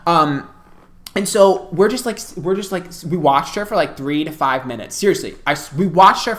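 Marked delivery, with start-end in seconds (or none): none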